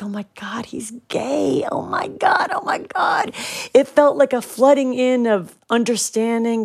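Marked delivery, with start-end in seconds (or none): 0.0-3.3 breathless whispered realisation, rising at the end; awed whisper. Retold or imitated. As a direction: whisper it as if the truth just hit you.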